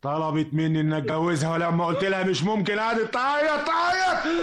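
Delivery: steady voice climbing into a high wail; building hysteria. Acted. Killer retelling the murder, climbing into hysteria. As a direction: start contained, rise steadily to frenzy.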